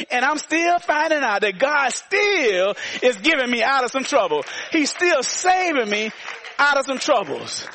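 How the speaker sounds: fervent pulpit delivery; preaching. Real, not acted. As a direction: proclaim it to the congregation, leaning on key words. Preacher testifies.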